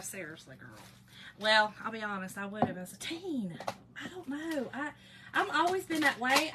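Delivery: normal talking voice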